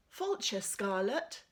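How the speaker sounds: put-on children's sing-song Irish welcome, Scarlett rising; chorus it like a class in Irish; a hint of a class chanting in Irish; Irish greeting. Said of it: "chapter eight has got some Irish phrases in it".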